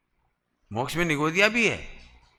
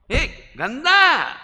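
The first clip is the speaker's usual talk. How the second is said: drawn-out mock disgust; 8 semitones higher, 7 dB louder, much slower than usual.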